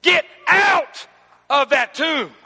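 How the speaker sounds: loud, high, booming command; commanding shout. Acted. Preacher as Jesus, shouting Lazarus out of the tomb. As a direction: roar it like a king at the tomb. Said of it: "He stood there like a man".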